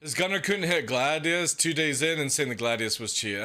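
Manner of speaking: ordinary talk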